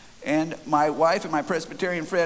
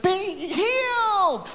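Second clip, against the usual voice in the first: high, long drawn-out cry; screamed healing command; 3 dB louder, much slower than usual. Retold or imitated. Healer finishing his prayer, screaming. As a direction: stretch it slowly into a high holler. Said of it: "screaming, yelling, shaking".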